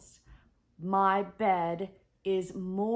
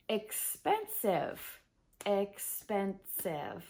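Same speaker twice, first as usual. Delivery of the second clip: expensive said slowly twice, each syllable separate; slow syllable demo; 4 dB quieter, slower than usual. Shown as a demonstration. Teacher to English learners, slow careful syllable demo. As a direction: draw out expensive so the three syllables show. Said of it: "That's a three syllable word".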